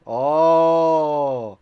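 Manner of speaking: long, loud "ohhh"; loud exasperated "oh". Real, not acted. Streamer's search is not working, loud exasperated "oh". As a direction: let out a big drawn-out groan of "oh".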